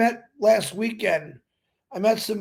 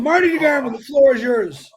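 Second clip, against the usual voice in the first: ordinary talk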